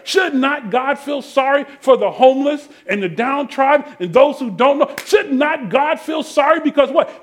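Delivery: full-force preaching. Real, not acted. Preacher urging the congregation to care for the lost, impassioned.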